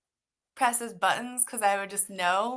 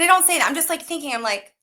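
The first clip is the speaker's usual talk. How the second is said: ordinary talk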